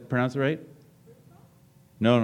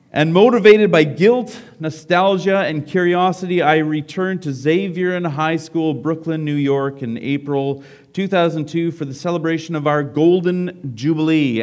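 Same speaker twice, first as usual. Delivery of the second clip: higher, louder read-aloud voice; story reading; 3 semitones higher, 6 dB louder than usual. A narrator's reading. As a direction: read it out to the whole room. Reads a book story aloud.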